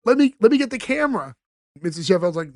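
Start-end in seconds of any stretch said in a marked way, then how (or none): 0.0-1.3 higher, gushing mock excitement; imitating a thrilled mom reaching for the camera; excited Fran. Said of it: "And she's excited".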